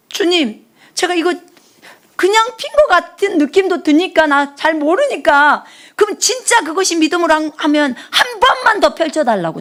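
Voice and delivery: high, urgent plea, slowing on one line; pleading prayer. Retold or imitated. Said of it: "내가 그랬어요".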